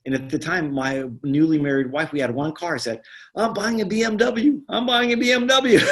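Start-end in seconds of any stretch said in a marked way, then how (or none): 3.3-5.8 high, laughing brag about buying a BMW; excited boast. Retold or imitated. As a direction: crow it out with glee, half laughing. Excited boast to new wife.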